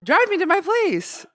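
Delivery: high, loud, slow demand; comic fed-up yell; exasperated order. Retold or imitated. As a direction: shout it slowly at a useless driver. Acted retort to pushy driver.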